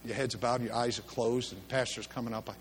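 low, soft invitation over music; altar call. Acted. Preacher quietly inviting the congregation to stand, soft. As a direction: invite them forward gently.

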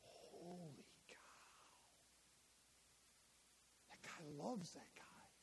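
whispered, amazed, barely voiced; awed whisper. Onlooker at Lazarus's tomb, whispering in awe. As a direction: breathe it out in hushed wonder. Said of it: "look at Jesus in that movie and say".

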